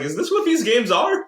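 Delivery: high, amazed question; mock shock at the game; incredulous. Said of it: "I was like".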